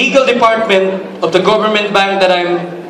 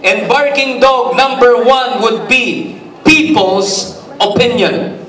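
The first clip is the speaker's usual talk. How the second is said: higher, raised preaching voice; a pastor at full lift; 4 semitones higher than usual. Acted. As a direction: preach it out to the hall.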